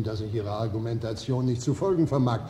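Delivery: ordinary talk, nothing marked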